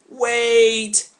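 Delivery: high, loud drawn-out wait; mock-alarmed protest. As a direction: stop her with a stretched cry. Adam balks at the fruit.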